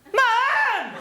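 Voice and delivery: very high, loud, long yell. Retold or imitated. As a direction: yell for mum like a child. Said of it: "I yelled out".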